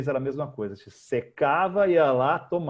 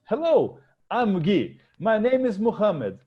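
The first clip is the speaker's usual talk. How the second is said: slow, higher mock Omani client repeating the name; imitating the client; 7 semitones higher, 4 dB louder, slower than usual. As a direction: echo the name back like the client. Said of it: "E o cara olha pra mim". (imitando o cliente repetindo o nome, agudo e lento)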